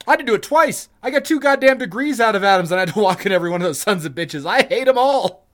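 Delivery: loud, high, exasperated outburst; worked-up rant. Real, not acted. Graduate griping about forced parade marching, exasperated rant. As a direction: rail indignantly, punching the complaints.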